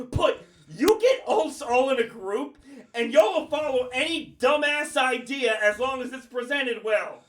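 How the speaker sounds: real fed-up shouting at the delays; angry rant. Real, not acted. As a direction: vent every grievance, building to yelling. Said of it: "I'm fucking pissed".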